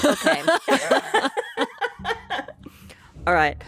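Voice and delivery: ordinary delivery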